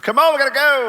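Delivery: loud, high hurry-up call to the wife; exasperated husband at the door; impatient call. Retold or imitated. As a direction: call out, come on let's go.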